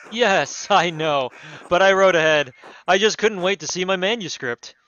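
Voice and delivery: loud, booming noble voice; baron voice. Acted. Baron barging into the abbey, booming and pompous. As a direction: announce yourself like a pompous lord. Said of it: "these fucking wild accents".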